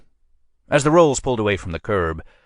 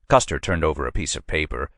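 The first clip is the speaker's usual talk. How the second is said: usual speaking voice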